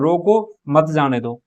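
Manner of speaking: रोको set apart, then मत जाने दो together; pause after रोको. Shown as a demonstration. Teacher explaining the first meaning, pause after रोको. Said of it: "रोको के बाद विराम चिन्ह लगाओगे".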